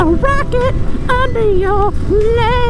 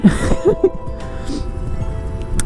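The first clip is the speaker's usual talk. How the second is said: breathy laugh; 10 semitones higher, 6 dB quieter than usual. Real, not acted. Laughing at camera complaint.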